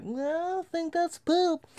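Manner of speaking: high-pitched silly voice for himself; high mock voice. Telling Dave about the poop, high mock innocent voice. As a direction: squeak it out, feigning innocence. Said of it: "I was like".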